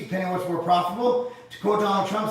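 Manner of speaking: normal talking voice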